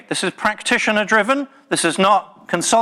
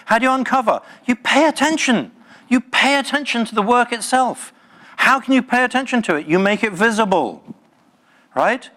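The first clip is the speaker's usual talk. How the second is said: raised, intense and insistent; fired-up speaker pressing the room; passionate; 6 semitones higher than usual. Real, not acted. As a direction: drive the point home with fervour. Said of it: "Sorry, I'm not angry. I'm just passionate".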